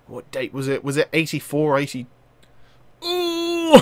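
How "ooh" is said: long, high wail of dismay; frustrated high ooh. Real, not acted. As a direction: squeal at your own mistake. Reacts to forgotten date.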